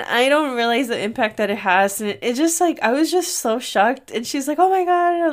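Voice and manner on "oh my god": higher, excited exclamation. Retold or imitated. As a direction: gush it out. Friend greets Roxy excitedly.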